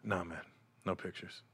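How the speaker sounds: low, very soft brush-off; imitated low-key refusal; hushed refusal. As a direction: murmur a quiet no. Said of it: "I'm whispering in his ear".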